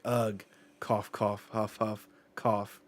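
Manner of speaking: coughing and huffing noises in a put-on voice; mock coughing. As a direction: act out a character hacking and gasping. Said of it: "And we hear".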